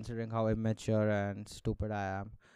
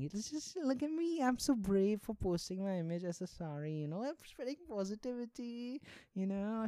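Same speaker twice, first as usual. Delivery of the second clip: much higher, airy self-praise voice; mock vain poster; 10 semitones higher, 4 dB quieter than usual. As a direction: squeal like a smug influencer.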